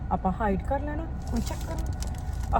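secretive whispered aside, slow. Pretend. Plans to hide it from husband. (ਹੌਲੀ ਘੁਸਰ-ਮੁਸਰ)

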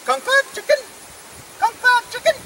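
high-pitched fake Chinese voice; mock Chinese. Asking the horse for its hoof, mock Chinese. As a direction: squeak it out as pretend Chinese. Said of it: "Chinese, okay?".

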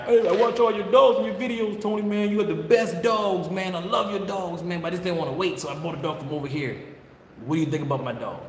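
gushing caller voice pitched high; mock eager customer. A fan pitching his dog to the breeder, high and gushing. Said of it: "Like a guy come".

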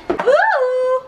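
high, delighted ooh; delighted gasp. Real, not acted. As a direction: coo in wonder at a gift. Opening a gift.

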